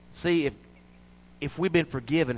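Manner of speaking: usual speaking voice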